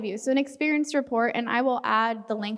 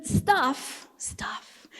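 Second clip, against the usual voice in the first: whispered. Acted. Playful aside.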